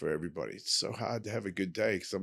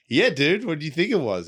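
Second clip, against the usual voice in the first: louder and higher, mock indignant; exasperated retort; 7 semitones higher, 9 dB louder than usual. To his son about the cigar, mock indignant and loud. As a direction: fire it back like it's obvious.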